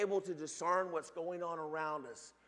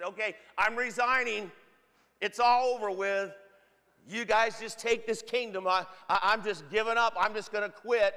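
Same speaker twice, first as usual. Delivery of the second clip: raised, louder voice acting out giving up; mock resignation; 3 semitones higher, 6 dB louder than usual. King quitting before everybody, acted out in a raised voice. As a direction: announce loudly that you're throwing in the towel.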